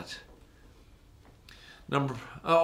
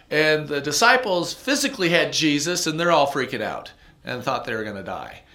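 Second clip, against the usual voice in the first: ordinary talk, nothing marked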